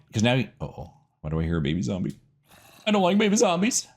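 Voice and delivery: normal talking voice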